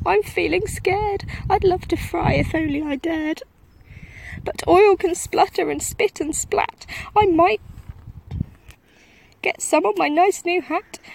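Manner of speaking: high, loud trembling cook voice; scared cook. A narrator's reading. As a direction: babble your fears like a terrified wimp. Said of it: "the cook declared".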